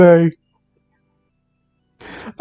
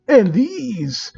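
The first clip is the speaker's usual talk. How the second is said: lewd character voice; 5 semitones higher, 4 dB quieter than usual. Acted.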